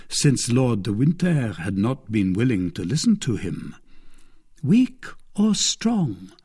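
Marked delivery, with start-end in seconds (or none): 4.6-6.3 slow, higher, scheming murmur; a hint of a plotting captive; milady musing. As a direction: turn the words over cunningly. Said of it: "repeated milady".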